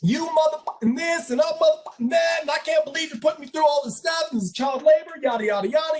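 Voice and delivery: high-pitched mock tirade; retold angry rant. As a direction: act out a student losing it. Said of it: "he just loses it on him".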